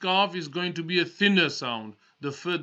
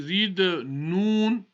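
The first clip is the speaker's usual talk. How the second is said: ordinary delivery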